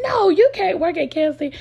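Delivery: high, crying plea; tearful pleading. Retold or imitated. Daughter begging dad not to work at KFC, high crying plea. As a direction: beg through tears, voice up high. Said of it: "my eyes started watering".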